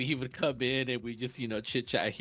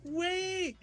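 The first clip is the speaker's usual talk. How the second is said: very high stretched wait; mock yelled wait. Lady yelling from her car, mock high plea. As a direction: call out after them, pleading. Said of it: "she was like, yelling at me".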